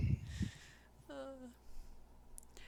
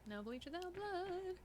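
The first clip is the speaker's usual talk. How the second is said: higher, slow, dramatic delivery; dramatic motto; 10 semitones higher, 5 dB quieter than usual. Acted. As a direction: intone it like a grim vow.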